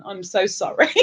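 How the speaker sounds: ordinary talk, nothing marked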